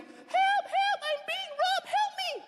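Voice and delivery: high falsetto squeal of a robbed woman; hammed-up helpless lady shrieking for help; mock lady's cry for help. Joking comic mimics robbed lady, high falsetto cry for help. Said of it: "The poor lady was like".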